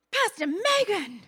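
loud, slow, hammed-up British accent; awful British accent. Youth group boy teasing his pregnant pastor, bad British accent. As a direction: call out in a badly faked British accent. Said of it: "I do an awful accent".

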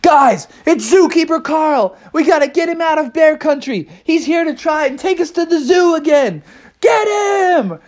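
high, urgent rallying cry; rally the group at a high pitch; urgent alarm. Acted. Bears rouse each other to chase the zookeeper, high urgent voice. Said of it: "everybody was like".

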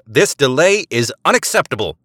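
high, loud angry command; impatient agent angrily calling back to the ship. A narrator's reading. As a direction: snap it back over comms. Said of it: "Eliza angrily called back".

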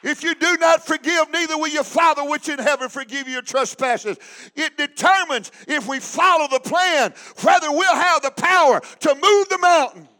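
loud, rising preacher's cry; preaching at full force. Acted. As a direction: drive the scripture home to the church.

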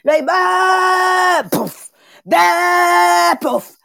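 drawn-out loud mock whoosh up then crash down; mock rise and crash. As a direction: act out a rocket soaring then falling. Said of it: "Ça fait vraiment comme ça".

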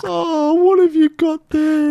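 very high, squeaky mock old-lady voice. Mocking the old lady fussing over the duck, squeaky and high. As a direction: pitch it way up like a fussy old lady. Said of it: "And she goes".

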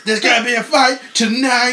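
big laughing ring-announcer yell; catchphrase shout. Pretend. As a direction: belt it out like a promo, laughing.

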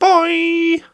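very high, loud squeaked farewell; squeaky goodbye. Pretend. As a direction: squeal a silly sign-off way up high. Put-on squeaky sign-off.

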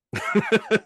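short high laugh at himself; laughing at his own bad voice. Real, not acted. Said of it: "That's a bad one".

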